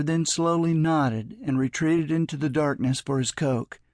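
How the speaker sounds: ordinary delivery, nothing marked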